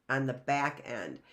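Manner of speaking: ordinary delivery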